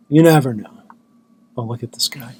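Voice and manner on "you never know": says it again louder, for emphasis; louder repeat. Shown as a demonstration.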